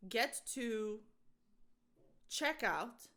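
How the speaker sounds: ordinary talk, nothing marked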